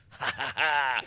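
acted-out belly laugh; imitated laugh. Preacher imagining Jesus laughing at Peter, imitated laugh. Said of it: "there's Jesus going".

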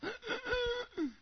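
put-on a sharp, high gasping cry, as the sick boy. Father mimics son's gasp, retelling the night, as a high gasping cry. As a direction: gasp for air the way the boy did.

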